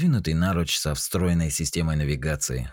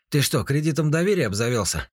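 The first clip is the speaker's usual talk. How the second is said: surprised; 10 semitones higher than usual. A narrator's reading. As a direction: ask in disbelief. Сима keeps asking, surprised.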